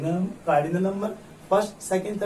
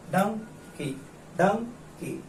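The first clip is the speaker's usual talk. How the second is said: Dunki split as डं, pause, की; two separated syllables; 4 semitones higher, 4 dB quieter, much slower than usual. Shown as a demonstration. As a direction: repeat Dunki in two distinct beats. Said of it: "दो syllable का word है". (डंकी को दो टुकड़ों में, डं-की)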